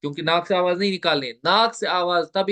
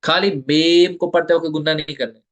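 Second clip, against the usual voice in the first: ordinary talk, nothing marked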